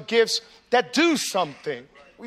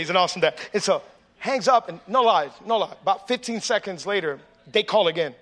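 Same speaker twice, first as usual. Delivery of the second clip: normal talking voice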